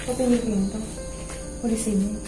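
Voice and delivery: normal talking voice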